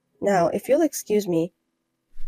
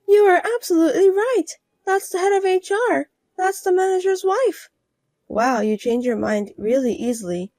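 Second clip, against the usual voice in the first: higher, sugary flattery; higher voiced woman flattering the manager's wife; fawning rival wife; 7 semitones higher than usual. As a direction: suck up in a higher voice. Sudden flattery.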